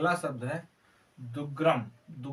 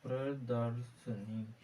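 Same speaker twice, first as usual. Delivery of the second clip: slow careful demo; 3 semitones lower, 5 dB quieter, much slower than usual. Shown as a demonstration. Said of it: "प्रदर्शनी का शुद्ध रूप होगा प्रदर्शनी".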